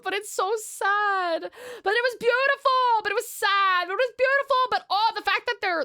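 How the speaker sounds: high, wailing enthusiasm; overwrought, high-pitched real outburst; emotional gushing. Real, not acted. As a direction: wail it, torn between sad and delighted. Overcome reader gushes about story.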